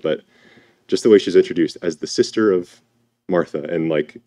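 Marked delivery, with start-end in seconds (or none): none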